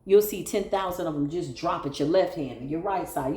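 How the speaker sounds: ordinary talk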